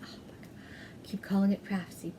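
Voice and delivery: whispered self-reproach; exasperated aside. Real, not acted. Podcaster scolding herself for calling it Craftsy, whispered aside. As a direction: whisper it to yourself under your breath. Muttering at own repeated mistake.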